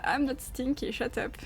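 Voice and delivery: ordinary talk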